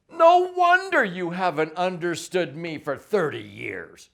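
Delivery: exasperated outburst. Retold or imitated. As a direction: blurt it out like a spouse who finally gets it. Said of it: "I hear things like".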